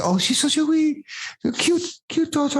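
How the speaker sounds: very high-pitched mock Scottish shop lady; terrible Scottish accent. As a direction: gush in a badly faked Scottish falsetto.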